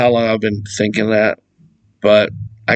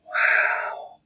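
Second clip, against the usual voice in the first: whispered, softer breath of awe; breathless wow; 9 dB quieter than usual. Retold or imitated. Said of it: "you're just like".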